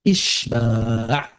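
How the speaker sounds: "isba'" with a long stretched final "a"; drawn-out vowel. Shown as a demonstration. Teacher names the lengthening rule, "isba'" stretched out. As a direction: stretch out the last syllable of "isba'". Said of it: "Nambahin panjang hal".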